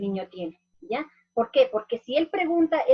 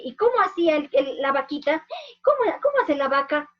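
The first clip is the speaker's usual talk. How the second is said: higher, louder, playful prompt to the class; playful question to kids; 4 semitones higher, 4 dB louder than usual. Shown as a demonstration. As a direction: invite the children to make the cow sound. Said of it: "con sonidos".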